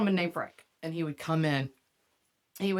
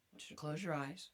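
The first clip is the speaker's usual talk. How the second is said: soft, low, coaxing instruction; mock seductive hush; 4 semitones lower, 14 dB quieter than usual. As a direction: coax him gently, barely aloud.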